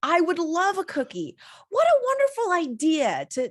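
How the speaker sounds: gushing mock delight. Officer thanks halfling for cookies, mock gushing. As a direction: gush as if this is the best gift ever.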